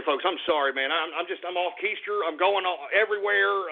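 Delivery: ordinary delivery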